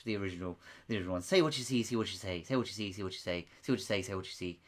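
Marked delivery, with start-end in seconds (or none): 1.2-4.6 put-on a deliberately poor Irish accent, lower and softer; bad Irish accent. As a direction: mimic an Irish game show host, not very well. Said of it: "My Irish accent isn't a very good one".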